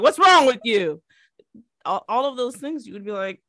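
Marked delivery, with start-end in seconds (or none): 0.0-1.0 slow, louder rude stranger voice; mock rude stranger. Friend mocks someone gawking at mismatched socks, mock rude. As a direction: rudely demand an answer. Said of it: "I'm gonna be like".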